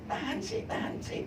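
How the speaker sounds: high, soft, breathy plea as God; a lightly voiced God urging patience; voicing God gently; God speaking. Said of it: "Tuhan ngomong gitu".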